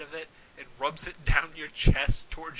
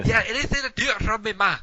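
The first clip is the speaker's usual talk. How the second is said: fast mumble; 5 semitones higher than usual. Acted.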